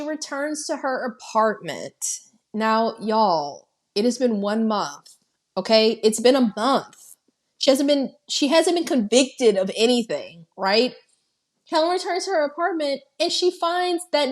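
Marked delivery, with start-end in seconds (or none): none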